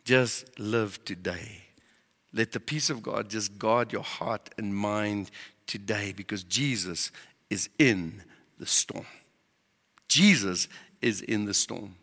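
low, soft, slow comforting words; gentle reassurance. Retold or imitated. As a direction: soothe your family slowly and softly. Gentle counsel to his family.

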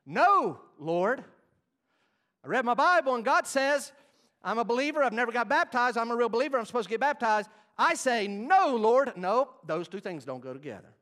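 high, booming pulpit challenge; full-force preaching. Real, not acted. Preacher challenging believers who refuse God, full-force preaching. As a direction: hammer the point home loudly.

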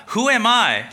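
startled Moses answering God; incredulous Moses. Acted. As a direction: blurt it out in shock. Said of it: "Moses said to God".

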